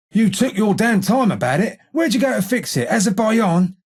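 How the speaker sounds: exasperated sarcasm; sarcastic grumbling. Acted. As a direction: chide them for dawdling. Grumbles at newcomer for delay.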